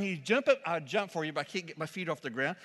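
ordinary talk

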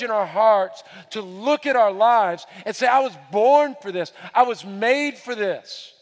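rally the believers, declare it; preaching at full force. Acted. Preacher urging believers to declare their calling, fervent.

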